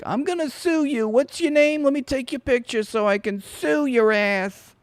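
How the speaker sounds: squeaky tantrum of a threatened man; mock Dershowitz. Mocking Dershowitz threatening the heckler, whiny and high. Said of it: "And he's like".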